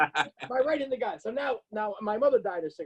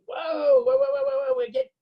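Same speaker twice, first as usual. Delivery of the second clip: high squealing imitation of a scared lady; hammed-up frightened whoa whoa; mock panicked lady; 4 dB louder than usual. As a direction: squeal it like a panicking old woman. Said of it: "Lady goes".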